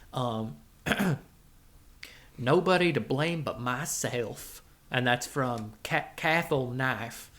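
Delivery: put-on folksy cowboy drawl accent; cowboy character accent. The Rattler presenting his Halloween game to the boys, cowboy accent. Rattler reads the first review.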